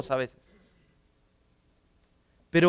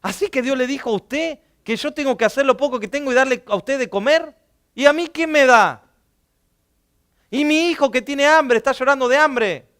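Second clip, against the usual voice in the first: indignant widow; 7 semitones higher, 6 dB louder than usual. Retold or imitated. Widow protesting to the prophet who asks her for food, mildly indignant. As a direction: protest as the widow with a hungry child. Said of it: "Imagínate la viuda diciendo".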